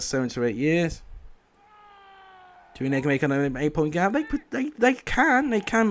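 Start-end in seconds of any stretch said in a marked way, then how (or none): 4.1-5.9 much higher, insisting; emphatic insistence. Real, not acted. Gamer answering his chat, high and insistent. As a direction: insist they really can.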